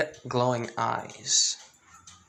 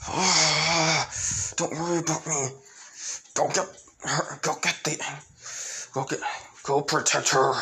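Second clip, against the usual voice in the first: pained; 2 semitones higher than usual. Acted. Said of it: "she starts screaming in pain".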